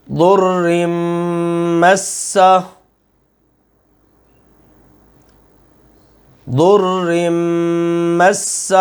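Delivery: ضر مس in two parts, the ر pressed firm, with a pause for students; tajweed recitation. Shown as a demonstration. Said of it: "میں پڑھتا ہوں، پھر وقفہ دیتا ہوں". (ضر مس، وقفے کے ساتھ)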